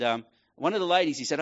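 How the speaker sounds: usual speaking voice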